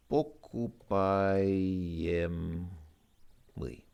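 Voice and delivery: pokupaiemli said very slowly, syllable by syllable; slow careful pronunciation. Shown as a demonstration. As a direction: sound out pokupaiemli for the class. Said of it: "Something which is bought".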